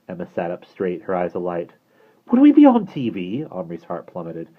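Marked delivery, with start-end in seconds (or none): 2.3-3.4 high, eager question rising; excited. A narrator's reading. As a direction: bubble over at the idea. Emma excited.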